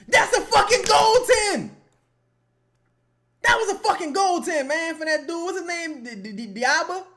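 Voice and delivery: real outraged yell; furious shout. Real, not acted. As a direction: scream at the screen. Yelling at an opponent's goal.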